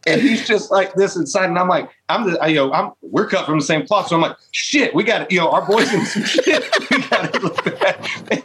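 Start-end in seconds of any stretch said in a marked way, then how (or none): 4.5-8.4 laughing alarm. Retold or imitated. Friend is in strangers' truck.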